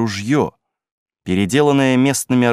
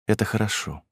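soft, understated acceptance; quietly, without any fuss; said simply; 6 dB quieter than usual. A narrator's reading. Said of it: "она сказала просто". (просто и тихо)

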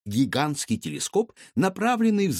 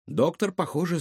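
ordinary delivery

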